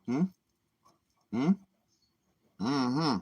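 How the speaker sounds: normal talking voice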